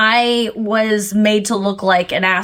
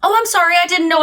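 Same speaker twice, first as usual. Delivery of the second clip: higher, apologetic; 8 semitones higher, much faster than usual. Retold or imitated. Apologizing to the annoyed airport guard, higher voice. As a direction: pitch up sweetly to smooth it over. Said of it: "And I go".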